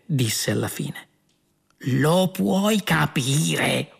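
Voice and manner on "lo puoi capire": incredulous cry, very drawn out; outraged yell. A narrator's reading. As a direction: stretch every word in outrage. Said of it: "urlò Arthur". (urlo indignato, molto strascicato)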